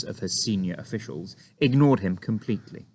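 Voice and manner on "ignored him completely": ordinary delivery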